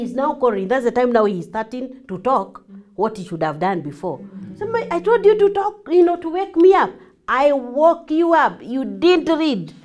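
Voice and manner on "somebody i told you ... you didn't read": loud, high, indignant complaint; scolding. Acted. Late sleeper blames partner for not waking them, scolding. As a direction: raise your voice in a heated telling-off.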